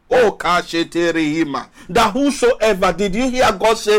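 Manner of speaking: ordinary delivery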